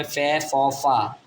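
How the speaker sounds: ফে, ফ, ফা as three separate syllables; pronunciation options. Shown as a demonstration. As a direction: sound out ফে, ফ, ফা one by one. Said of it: "ফি এ উচ্চারণ হবে".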